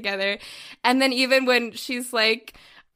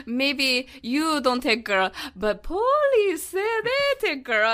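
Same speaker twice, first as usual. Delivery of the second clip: Italian man's broken English; 2 semitones higher, slower than usual. Retold or imitated. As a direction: lightly mimic an Italian man pushing her off to the police. Italian man turning her away.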